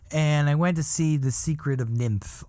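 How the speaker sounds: ordinary talk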